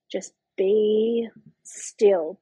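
very slow, drawn-out self-instruction; slow self-soothing. Retold or imitated. Labouring mother telling herself to hold still, very slow and measured. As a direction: stretch it out with pauses between words.

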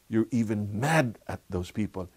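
normal talking voice

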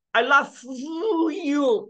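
"love" with an exaggerated, stretched hissing end before "you"; high, mock overdone "I love you"; wrong drawn-out pronunciation. Shown as a demonstration. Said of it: "不可能的吗".

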